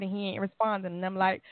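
ordinary talk, nothing marked

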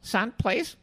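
put-on high, exasperated old lady plea; old secretary. Old secretary insisting the fan leave, high exasperated voice. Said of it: "and she goes".

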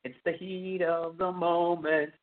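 mock innocent guy, pitched high; a lightly hammed-up smooth talker brushing off her fear; high, coaxing, slowing at the end; Tom Savini playing innocent. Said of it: "And Tom was like".

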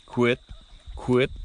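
short quit sounds like a duck; duck quit call. Shown as a demonstration. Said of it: "So it's just".

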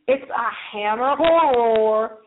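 northern twang. Shown as a demonstration. Host first says hammer horror in her northern twang. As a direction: drop the r in "horror", northern style. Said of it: "That's my northern twang".

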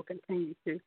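normal talking voice

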